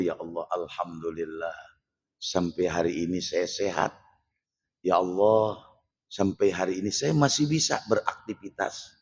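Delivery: low, soft, unhurried thanksgiving; a gentle, humble murmur of thanks to God; reverent grateful prayer. Acted. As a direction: pray it quietly, counting blessings. Quotes grateful prayer of the sufi.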